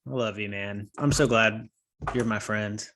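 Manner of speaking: usual speaking voice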